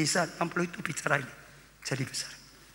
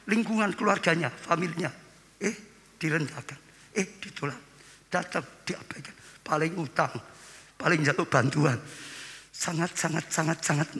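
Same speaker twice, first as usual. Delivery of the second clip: normal talking voice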